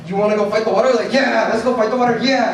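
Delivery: high, loud battle cheer; rallying cry. Retold or imitated. Counselor firing up campers at the beach, loud cheer. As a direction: whip the boys into a frenzy.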